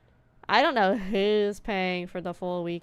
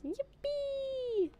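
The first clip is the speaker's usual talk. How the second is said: excited cheer; 4 dB quieter than usual. Real, not acted. Cheering at earning money.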